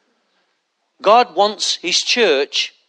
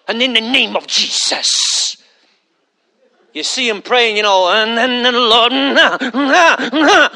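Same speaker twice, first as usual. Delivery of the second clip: mock preacher voice; slower than usual. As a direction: parody a showy preacher working the crowd. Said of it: "if you put a funny voice on it".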